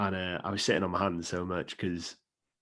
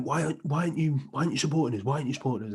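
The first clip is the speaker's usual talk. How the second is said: higher, indignant demanding; lightly voiced annoyed England fan; mock angry English fan; 6 semitones higher, faster than usual. English lad in the pub demanding why he won't support England, mock indignant. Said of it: "He went".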